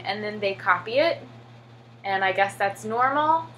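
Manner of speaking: normal talking voice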